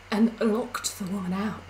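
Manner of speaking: usual speaking voice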